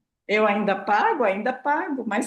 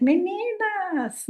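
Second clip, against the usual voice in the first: "Meninas" stretched very slowly and higher; childish way; 9 semitones higher, much slower than usual. Shown as a demonstration. Contrasting the childish greeting, sing-song and slow. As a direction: drag out "Meninas" like talking to kids. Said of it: "é diferente".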